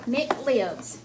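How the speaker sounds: normal talking voice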